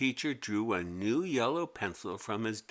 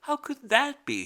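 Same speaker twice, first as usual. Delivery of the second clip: put-on much higher, baffled kid; puzzled girl voice; 13 semitones higher than usual. A narrator's reading. Mimi asking herself who used the sharpener, high puzzled. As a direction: wonder aloud, high. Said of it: "she asked herself".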